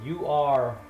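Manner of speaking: ordinary talk, nothing marked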